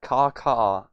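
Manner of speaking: playful crow cawing. Pretend. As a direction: caw like a crow. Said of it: "I'm a bird".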